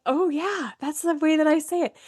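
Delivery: lively "oh, of course" quote; mock realisation. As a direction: act out a light-bulb moment. Acting out a sudden realisation.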